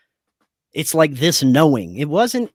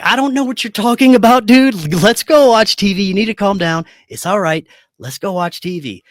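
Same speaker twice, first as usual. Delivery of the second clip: higher, louder easygoing buddy voice; casual friend; 6 semitones higher, 6 dB louder than usual. Retold or imitated. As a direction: brush it off like a chill friend. Corey acts normal, calms him.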